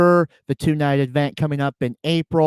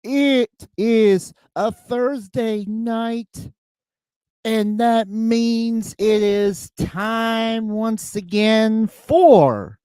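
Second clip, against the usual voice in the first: slow, booming ring announcer call; grand entrance announcement; 5 semitones higher, slower than usual. Acted. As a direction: stretch every word out like an arena announcer. Opens his show like a ring announcer.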